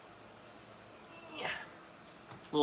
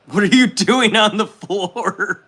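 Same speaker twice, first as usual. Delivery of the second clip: higher, louder, slow incredulous question; baffled loud question; 7 semitones higher, 7 dB louder than usual. Real, not acted. Asking a viewer about her floor work, loud and baffled. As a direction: drag it out in disbelief.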